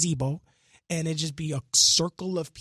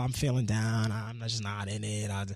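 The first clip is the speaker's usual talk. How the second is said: mopey excuse; 5 semitones lower, 4 dB quieter than usual. Retold or imitated. Turning down his friends, low and mopey. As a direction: drag it out like you can't be bothered.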